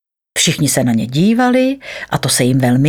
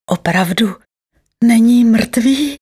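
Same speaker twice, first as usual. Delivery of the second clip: slow, hopeful question lifting higher; 6 semitones higher than usual. A narrator's reading. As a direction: ask with tentative, drawn-out hope. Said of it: "ptal se s nadějí". (otázka s nadějí, protáhle)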